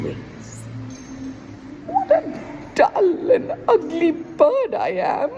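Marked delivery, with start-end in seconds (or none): 1.9-5.4 high, loud cawing lament with a cackle; a crow crowing over his dull feathers. A narrator's reading. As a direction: squawk it out like a self-pitying crow. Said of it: "he crowed".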